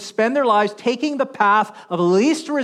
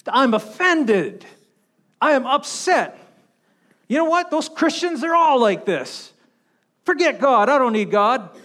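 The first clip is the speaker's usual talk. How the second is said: higher, louder mock of an offended man, pitch jumping up on "You know what"; offended huffy man; 6 semitones higher, 4 dB louder than usual. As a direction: ham up a huffy, insulted churchgoer storming off. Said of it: "he goes".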